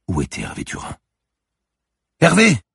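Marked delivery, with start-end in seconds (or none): 2.2-2.6 sudden high panicked shout; crying out a name. Acted. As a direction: call out his name in a burst of alarm. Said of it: "se surprit-elle à crier".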